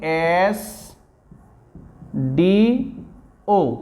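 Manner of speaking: "एस डी ओ" with long "एस", pauses between letters; drawn-out pronunciation. Shown as a demonstration. Teacher proving why AN is used, "एस डी ओ" slowly stretched. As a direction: hold "एस", then separate "डी" and "ओ". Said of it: "यहाँ भी हमारा A N लगेगा क्योंकि".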